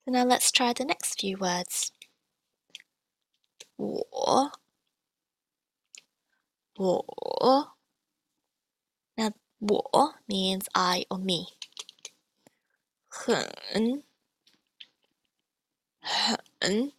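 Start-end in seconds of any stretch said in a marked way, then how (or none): 3.8-7.8 我 drawn out, dipping then rising; slow demonstration of 我 with a falling-rising tone; slow third tone. Shown as a demonstration. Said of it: "Now let's do this slowly". 13.1-16.9 很 drawn out, dipping then rising; slow demonstration of 很 with a falling-rising tone; slow third tone. Shown as a demonstration. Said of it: "Now let's do this slowly".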